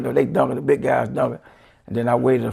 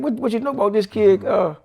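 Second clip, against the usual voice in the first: put-on higher, eager voice asking about the kid; lightly voiced coach, raised pitch; curious question; 8 semitones higher, 4 dB louder than usual. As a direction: press him with quick curiosity. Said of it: "he said".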